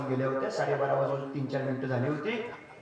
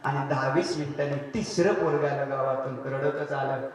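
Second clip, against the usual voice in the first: usual speaking voice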